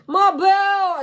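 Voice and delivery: high, loud street call; loud high catcall. Shown as a demonstration. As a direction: belt it out like calling a girl on the street. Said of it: "tu veux que je te montre comment je suis dans la rue".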